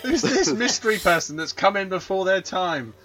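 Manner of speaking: high, loud, laughing fake astonishment; mock surprise. Co-host introducing a mystery voice to listeners, mock surprised. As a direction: make a big show of the mystery guest.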